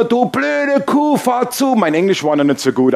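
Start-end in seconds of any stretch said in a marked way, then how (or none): 0.0-1.8 high, slow mock abuse out the car window; mock insult. Preacher retelling yelling at the slow driver, mock angry. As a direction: yell it through the open window, hammed up. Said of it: "hab ich sie auf Deutsch beschimpft".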